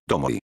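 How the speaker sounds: "tomo y" ending in a vowel glide; J as Latin I. Shown as a demonstration. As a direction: pronounce the end of "tomo y" like "oy" in Spanish "hoy".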